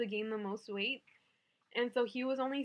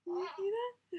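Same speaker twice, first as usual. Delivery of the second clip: much higher playful coo; 10 semitones higher than usual. Real, not acted. Mom showing off her baby's monster shirt, high playful coo. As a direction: coo it like talking to an infant.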